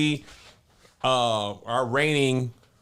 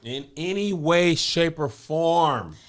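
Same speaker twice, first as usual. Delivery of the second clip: "shape, or form" drawn out slowly, higher pitch; emphatic agreement, lingering on FORM; emphatic echo; 6 semitones higher, slower than usual. Real, not acted. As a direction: echo it high, stretching FORM. Echoes co-host's phrase for emphasis.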